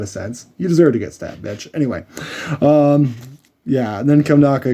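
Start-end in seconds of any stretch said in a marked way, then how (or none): none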